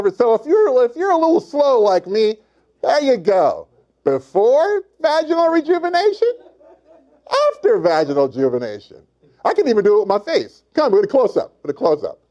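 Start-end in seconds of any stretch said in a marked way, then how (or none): 4.0-6.3 high, rising comic demonstration; high-pitched "before". Shown as a demonstration. 7.5-9.0 falling back to the normal register; settled "after". Shown as a demonstration. Before and after comic demonstration.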